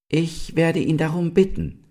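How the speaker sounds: put-on high, clear young deer. A narrator's reading. Young deer volunteers to ask the stag, clear-voiced. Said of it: "sagte Ulla mit klarer Stimme".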